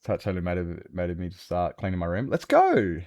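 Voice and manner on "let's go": excited. Real, not acted. Excited cheer while cleaning.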